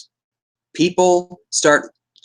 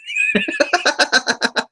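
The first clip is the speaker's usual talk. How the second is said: high laugh. Real, not acted. Reacting to the Joker scene.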